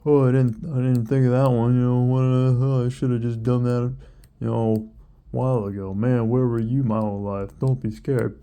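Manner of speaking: loud, hammed-up sarcastic amazement; over-the-top snarky praise; snarky mock. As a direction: gush over it loudly, dripping with sarcasm. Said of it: "snarky about it".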